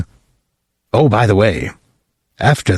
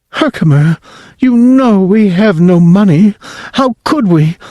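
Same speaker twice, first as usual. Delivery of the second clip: higher, louder fretting mother; worried mother; 9 semitones higher, 3 dB louder than usual. Acted. Mother telling her husband they have no money, higher and anxious. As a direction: fret like a poor mother.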